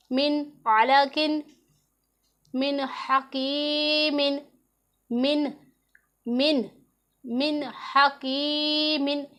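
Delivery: slow, drawn-out recitation with a clear नून in मिन; Quranic recitation. Shown as a demonstration. Teacher reciting the examples slowly for her students. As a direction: recite मिन हकीमिन slowly, no nasal hiding. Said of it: "मिन की आवाज़ को गौर से सुने".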